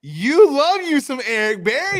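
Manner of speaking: high squeaky mock echo of his words; playful high-pitched ribbing; teasing mimicry. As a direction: tease him by parroting it back way up high. Teasing echo of co-host's phrase.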